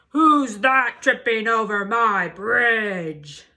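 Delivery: slow, loud troll roar. Acted. Troll roaring at the goat on his bridge, slow and loud.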